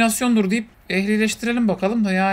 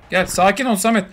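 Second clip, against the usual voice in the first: ordinary delivery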